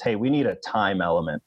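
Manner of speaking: ordinary delivery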